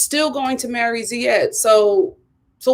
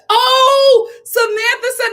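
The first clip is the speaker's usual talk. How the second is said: excited; 12 semitones higher, 6 dB louder, much slower than usual. Real, not acted. Excited at a super chat.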